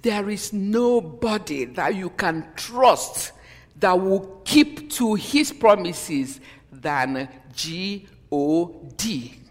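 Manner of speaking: very slow, spelling out "G O D" letter by letter; emphatic slow spelling. Acted. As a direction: lean on each letter of "G O D".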